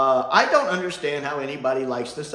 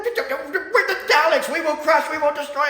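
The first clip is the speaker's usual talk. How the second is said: angry head Dalek; 7 dB louder than usual. Retold or imitated. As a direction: bark it out like an offended Dalek commander. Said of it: "the head Dalek takes personal affront to that".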